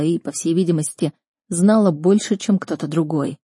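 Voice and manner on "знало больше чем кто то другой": ordinary delivery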